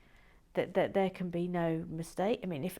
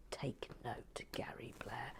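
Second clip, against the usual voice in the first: much softer, lower pointed aside; muttered aside; 6 semitones lower, 12 dB quieter than usual. Real, not acted. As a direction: drop right down for a sly dig. Pointed aside to another member.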